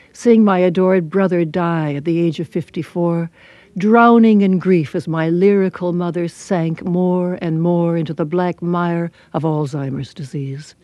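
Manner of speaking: usual speaking voice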